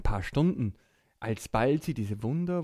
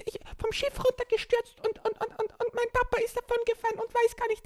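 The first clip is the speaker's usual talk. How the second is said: put-on upset stammering child; faster than usual.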